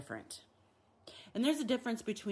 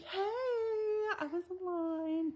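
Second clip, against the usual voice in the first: high, slow, drawn-out whine; mock whining complaint; exaggerated whiny; 13 semitones higher, much slower than usual. Shown as a demonstration. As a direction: overdo it like a wailing child. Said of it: "don't have to be as like".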